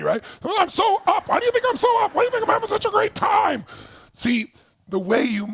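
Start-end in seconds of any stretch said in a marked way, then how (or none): 0.4-3.6 mock excited shout. Mocking the gloomy fan with a high excited shout. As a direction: burst with fake over-the-top joy. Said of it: "look at your body".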